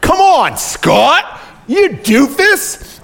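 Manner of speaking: very high, loud playful scolding; mock exasperation. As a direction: berate him in comic disbelief. Mock exasperation at wrong prediction.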